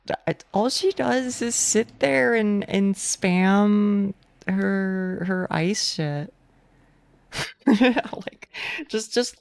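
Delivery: exasperated ranting at the game; gamer rage. Acted. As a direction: vent frustration at the boss. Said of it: "that's my gamer rage".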